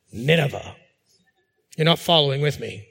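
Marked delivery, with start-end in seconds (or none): 0.1-0.8 low, softened name as if spitting it away; mock disdain. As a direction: demonstrate contempt for the congregation to copy. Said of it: "spit over your left shoulder".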